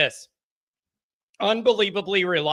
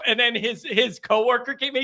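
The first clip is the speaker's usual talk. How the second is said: ordinary talk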